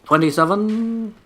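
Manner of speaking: drawn-out number call, slow; bingo caller. Retold or imitated. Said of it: "Sound like a fucking bingo caller".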